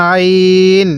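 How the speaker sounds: আইন squeezed lightly in the throat, long held ee; drawn-out throaty আইন; light throat pressure. Shown as a demonstration. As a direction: stretch আইন from the throat on a level note. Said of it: "গলার মধ্যে হালকা চাপ দিয়ে".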